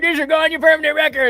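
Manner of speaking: high, loud school scolding voice; school authority figure. Retold or imitated. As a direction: mimic a high-pitched warning from high school. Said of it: "back in high school, it's like".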